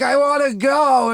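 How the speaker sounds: put-on high, loud kid-like whine to leave; whiny plea. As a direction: beg to go home, loud and high. Whining to leave the mall.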